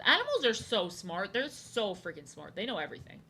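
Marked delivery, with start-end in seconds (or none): none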